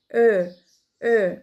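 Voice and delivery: او with tightly rounded lips. Shown as a demonstration. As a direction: round the mouth for او. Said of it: "خلوا بالكم من تدويرة البق".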